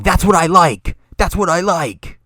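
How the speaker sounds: imitated in-your-face shout; mock yell. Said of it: "yell at him, yelling".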